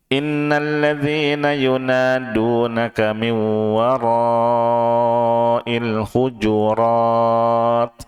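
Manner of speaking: slow, drawn-out melodic tilawah; measured Quranic chanting, slow and sustained; Quran recitation. Shown as a demonstration. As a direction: chant each word out slowly for the class to follow. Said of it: "Ikuti saya".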